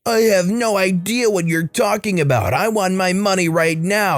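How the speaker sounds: high-pitched mock customer demanding; entitled customer. Customer denying fraud, insulting agent.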